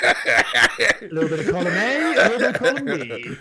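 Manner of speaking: laughing. Real, not acted. Laughing reply.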